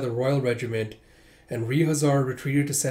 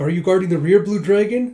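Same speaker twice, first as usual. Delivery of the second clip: higher, louder call to a comrade; called question; 6 semitones higher, 8 dB louder than usual. Acted. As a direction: shout the question across the field. Calling to comrade in battle.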